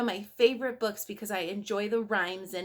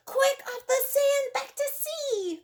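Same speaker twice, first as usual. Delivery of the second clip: tiny snail crying out, much higher; urgent little snail calling out. Acted. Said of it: "cried the snail".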